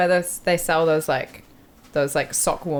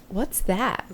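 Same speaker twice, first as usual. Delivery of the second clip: put-on higher American accent, puzzled question; imitated American accent; 4 semitones higher, 4 dB quieter than usual. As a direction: play a clueless American. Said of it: "That's a really good accent".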